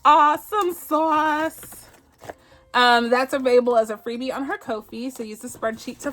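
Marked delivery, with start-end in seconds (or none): 0.0-1.9 high, slow "Awesome sauce"; happy sing-song cheer; excited drawn-out cheer. Real, not acted. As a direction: stretch it out in delight. Celebrating finished challenge.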